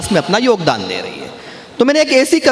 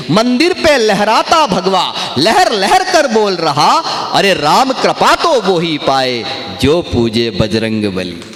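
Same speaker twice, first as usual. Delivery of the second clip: slow, drawn-out poetic declamation; kavi sammelan recitation; 3 semitones lower, slower than usual. Acted. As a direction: recite each line with relish, stretching the words. Recites devotional poem lines. (मंच पर कविता पाठ का धीमा, खिंचा हुआ अंदाज़)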